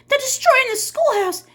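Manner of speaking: high, loud breathless alarm; gasping for breath. Acted. Miss Catherine bursting in on the sheriff, high and loud alarm. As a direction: blurt it out, winded from running. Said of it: "she said, gasping for breath".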